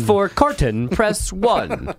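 automated phone-menu voice. Pretend.

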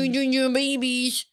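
put-on slow cooing baby talk to pets; dog and cat voice. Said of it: "that's my dog and cat voice".

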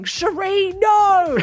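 high, loud sleep-talk scream; re-enacted nightmare yell, high and loud. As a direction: scream out in mock terror from a nightmare. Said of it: "I would wake up screaming".

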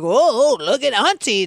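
normal talking voice

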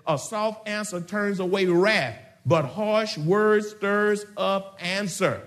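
slow, deliberate reading of the verse; scripture reading. Acted. Preacher reading Proverbs to the congregation, slow and weighty.

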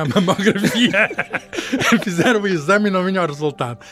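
laughing. Real, not acted. Guest laughs telling the satellite story.

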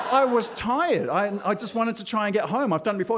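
high, lightly whiny self-defence; defensive protest. Retold or imitated. Defending himself to scolding doctor.

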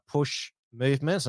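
ordinary delivery

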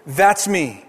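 normal talking voice

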